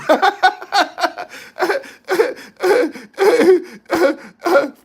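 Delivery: big high burst of laughter; loud laugh. Real, not acted. Streamer to chat about the misspelled name, loud cackle.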